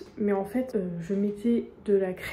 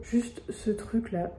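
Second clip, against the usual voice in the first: whispering; slower than usual. Real, not acted. Whispered product reveal.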